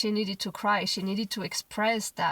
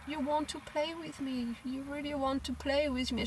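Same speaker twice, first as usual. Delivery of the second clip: soft, higher, gentle comforting; tender soothing; 5 semitones higher, 5 dB quieter than usual. Retold or imitated. Mother comforting her crying daughter, soft and tender. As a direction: soothe her as she cries. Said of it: "So I just tell her".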